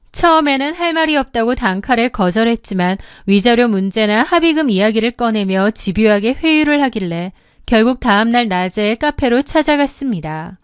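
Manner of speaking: ordinary delivery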